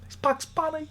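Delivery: put-on high, soft thrilled childlike voice; light up like a kid seeing cartoons; a child gasping at Bugs Bunny; excited kid. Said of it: "You go".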